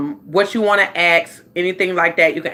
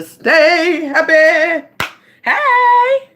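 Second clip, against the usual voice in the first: high, slow happy sing-song; cheerful squeal; 9 semitones higher, 5 dB louder, much slower than usual. Real, not acted. Host sings out her goal of staying happy. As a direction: sing it out brightly.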